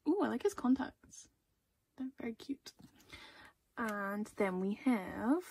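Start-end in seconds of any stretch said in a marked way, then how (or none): 2.0-2.7 soft whispered aside; whispered admiration. Real, not acted. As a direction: murmur it just for yourself. Admiring card under her breath.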